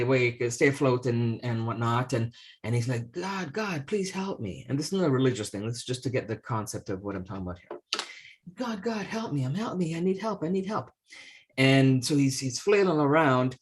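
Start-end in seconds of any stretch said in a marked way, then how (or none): 3.1-4.6 higher, softer begging from the water; mock desperate plea. Drowning priest pleads to God. 8.5-10.9 higher, softer, frantic cries for help; mock frantic plea. Drowning priest pleads again.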